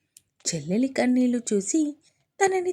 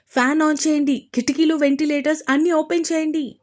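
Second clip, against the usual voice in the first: higher and louder, urgent fast yell; shouted orders; 6 semitones higher, 5 dB louder than usual. Acted. Advaith yells to the room to clear the smoke, shouting. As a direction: call out over the smoke to everyone. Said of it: "గట్టిగా అరుస్తూ చెప్పడంతో".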